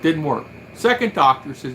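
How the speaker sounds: ordinary delivery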